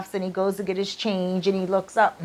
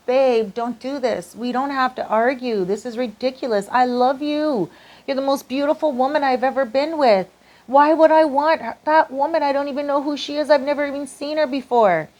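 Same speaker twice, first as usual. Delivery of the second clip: acted-out plea climbing higher as it goes; exasperated pleading; 5 semitones higher than usual. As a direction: plead with a partner, building to high-pitched protest. Acted boyfriend pleading during quarrel.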